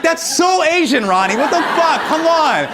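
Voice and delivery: high, exasperated comic scolding; mock exasperation. Comedian recounting how he chides Ronnie, mock exasperated. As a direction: scold a friend in drawn-out, high-pitched disbelief.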